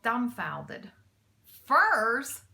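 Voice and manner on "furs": loud rising furs, amazed; cried out in disbelief. A narrator's reading. Mac learns what thieves want, disbelieving cry. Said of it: "he cried out".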